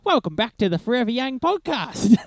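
exaggerated broad Aussie announcer; ultimate Aussie. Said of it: "you're like".

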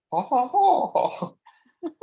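high, swooning ohs; acted-out bliss. Listener reacting to the sequel, blissfully overwhelmed. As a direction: play overcome with delight.

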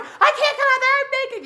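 very high, loud mock wail; hammed-up hysterical crying shriek. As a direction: imitate bawling through a closed door. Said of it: "You know, like, crying".

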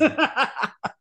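short high laugh. Real, not acted. Host to guest after agreeing to a drink, laughing. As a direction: burst out in a brief, high laugh.

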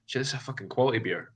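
usual speaking voice

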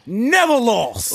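high, loud and drawn-out yell; boastful shout. Real, not acted. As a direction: stretch the boast out at full voice. Repeats the shouted team boast.

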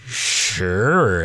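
long, droning uhs before answering; buffering hesitation. Retold or imitated. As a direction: stall like a frozen computer. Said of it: "buffer like a Windows computer".

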